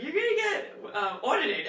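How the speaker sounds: high, loud imitation of sister; mock alarm. Sister warning her about the big tax refund, in mock alarm. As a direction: cry it out as a teasing warning.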